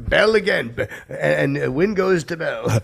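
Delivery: loud, high sportscaster call; mock sports announcer. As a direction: call it like a big win at the stadium. Mock sports call about fart.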